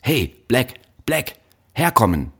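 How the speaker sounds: high, angry call; furious summons. A narrator's reading. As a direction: summon an underling in a rage. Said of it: "Sein Gesicht war blaurot vor Wut".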